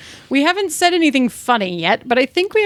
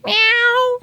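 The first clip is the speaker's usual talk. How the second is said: cat meow; 10 semitones higher than usual. Pretend. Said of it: "Are you gonna meow again?".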